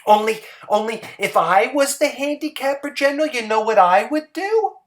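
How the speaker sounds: Hazel's voice. Acted. Hazel's voice in story.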